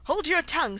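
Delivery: high, loud, furious order; angry command. A narrator's reading. Queen turning purple at Alice, loud angry command. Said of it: "turning purple".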